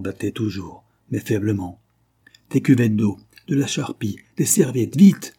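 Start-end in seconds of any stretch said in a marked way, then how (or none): 2.5-5.3 loud, rising urgent orders ending in a shout; urgent command. Acted. Doctor to the servants over the wounded man, urgent loud command. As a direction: rush the servants, louder to the last word. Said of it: "commanda-t-il".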